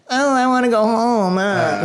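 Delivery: high, put-on whiny girl's voice; imitating a whiny cousin who wants to go home; mock whiny cousin. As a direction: whine like the bored cousin who wants to leave.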